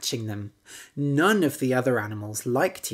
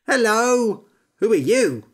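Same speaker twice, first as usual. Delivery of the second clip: higher, loud greeting; frog greeting; 7 semitones higher, 7 dB louder than usual. A narrator's reading. Frog greeting the fat pig, loud. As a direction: call out to the pig. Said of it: "asked the frog".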